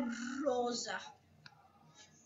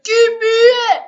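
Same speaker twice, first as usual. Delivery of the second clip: loud demanding shout; yelling; 8 semitones higher, 16 dB louder than usual. Real, not acted. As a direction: scream the demand at them.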